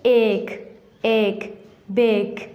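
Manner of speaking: एक, एक, बेक with long "ay" vowels; long A sound. Shown as a demonstration. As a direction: stretch एक to "ayk", then बेक to "bayk". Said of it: "ए को हम लंबी आवाज़ के साथ पढ़ते हैं".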